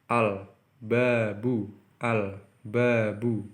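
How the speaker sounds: "Al-babu" said slowly with a distinct l before b; slow, careful "al-babu", l clearly heard; clear l demo. Shown as a demonstration. Teacher drilling students on a clear l, slow and careful. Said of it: "Ini huruf ba".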